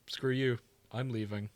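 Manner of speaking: usual speaking voice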